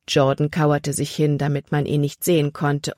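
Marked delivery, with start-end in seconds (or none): none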